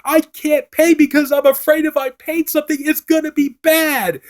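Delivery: high, whimpering mock Cole; whiny Cole. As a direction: whine it out like a scared kid. Cole whining in retold scene.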